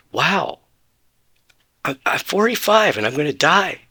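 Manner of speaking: rising, strained panic about dying; upset and scared. Retold or imitated. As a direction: let the fear climb as it sinks in. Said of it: "And so I got upset".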